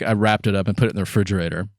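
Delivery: ordinary delivery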